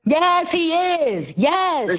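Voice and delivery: drawn-out, high-pitched emphatic yes; emphatic confirmation. Real, not acted. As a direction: insist on it, slow and high. Heated accusation in live argument.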